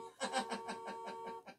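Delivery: squeaky high little car giggle. A narrator's reading. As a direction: giggle with glee.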